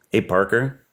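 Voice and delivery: ordinary talk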